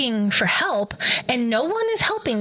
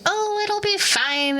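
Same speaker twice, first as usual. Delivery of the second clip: high, slow, carefree brush-off; high and slow airy dismissal; mocking breezy husband; 10 semitones higher, slower than usual. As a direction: imitate the husband shrugging it off, lightly mocking. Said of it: "And Aaron's like".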